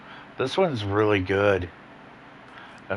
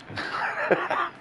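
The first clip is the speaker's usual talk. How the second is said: breathy laugh. Real, not acted. Laughs at garbage truck.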